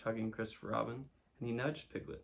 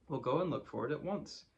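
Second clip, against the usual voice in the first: slightly raised, higher voice; calling out; 4 semitones higher, 4 dB louder than usual. A narrator's reading. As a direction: call it out lightly to a friend. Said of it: "he called out to Eeyore".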